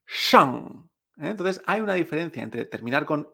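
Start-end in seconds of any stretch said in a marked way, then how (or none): 0.1-0.9 shang with a nasal ng; open-mouth ng in shang; Mandarin shang. Shown as a demonstration. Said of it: "que es con N G".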